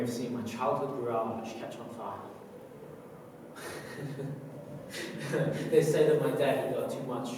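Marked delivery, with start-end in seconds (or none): 2.2-5.7 soft, breathy chuckle; quiet laughter. Acted. Recalling the childhood garage fire, quiet laughter. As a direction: chuckle under the breath at the memory.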